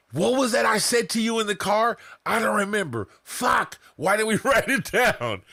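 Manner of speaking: hammed-up fuming at a lost idea; retold frustration. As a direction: replay the forgetful panic in the car. Retold frustrated car moment.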